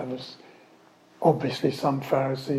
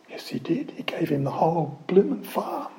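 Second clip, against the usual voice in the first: usual speaking voice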